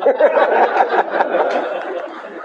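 high breathy laugh, unvoiced; laughter. Real, not acted. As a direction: laugh out at the absurdity. Laughs at his own story.

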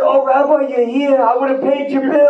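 high eager congregant voice, laughing. Retold or imitated. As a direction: gush like a fan spotting the rabbi. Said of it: "Someone said".

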